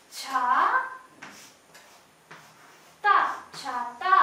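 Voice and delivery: छा and ता said apart, loud, then छाता joined; sounding out syllables. Shown as a demonstration. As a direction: split छाता into छा, ता, then blend. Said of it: "अब हम इसको लिखेंगे". (छाता शब्दांश बोलकर)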